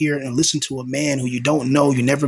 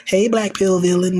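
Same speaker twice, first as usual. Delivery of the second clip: high, slow, sweet mock greeting; 5 semitones higher than usual. Imagining a girl fan greeting him, higher and drawn out. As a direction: play a girl fawning over him. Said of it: "girls watching me now be like".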